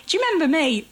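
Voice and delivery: high, bright, friendly local lady; a neighbourly stranger striking up chat; chatty Hull woman. Retold or imitated. Imitating a friendly Hull woman greeting her, high and bright.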